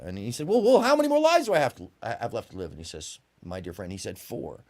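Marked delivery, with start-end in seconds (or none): 0.5-2.7 eager ascetic. Acted. Ascetic voiced in a parable. 3.4-4.6 quiet, solemn answer. Acted. Wise man gives the answer.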